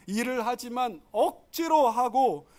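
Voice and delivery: ordinary talk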